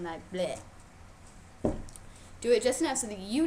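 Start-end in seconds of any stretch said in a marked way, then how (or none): 0.0-0.6 low limp "bleh"; silly dead-rabbit sound; playing dead noise. Shown as a demonstration. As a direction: flop over like a dead rabbit. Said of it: "flops over dead".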